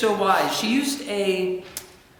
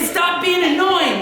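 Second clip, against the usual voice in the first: slow, high mock exasperation; put-on irritated sibling; annoyed sibling; 8 semitones higher, 3 dB louder, slower than usual. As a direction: drag out the complaint, pitched up. Acts out annoyed older sibling.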